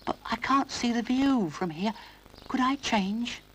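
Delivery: put-on high, timid little boy voice; Noddy's high childish voice; little toy boy. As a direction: apologise like a small toy.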